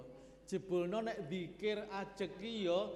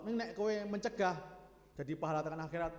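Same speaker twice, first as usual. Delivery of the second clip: normal talking voice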